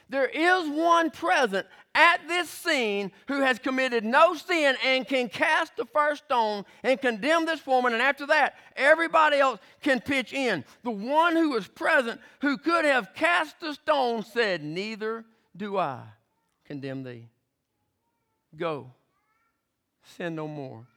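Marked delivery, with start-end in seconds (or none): 0.1-13.1 fervent preaching. Real, not acted. Preaching at full force. 14.6-20.8 soft, low and slow; tender forgiveness. Acted. Jesus forgiving the woman.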